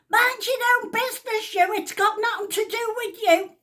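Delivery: put-on high, loud old lady yell; shouting. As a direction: snap at the chemist from across the room. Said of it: "She shouts".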